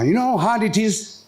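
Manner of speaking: ordinary talk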